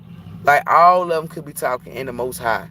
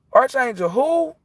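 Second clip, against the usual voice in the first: much higher and slower, disbelieving; incredulous; 13 semitones higher than usual. Retold or imitated. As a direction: stretch it out like you can't believe it. Disbelieving reply to a stranger.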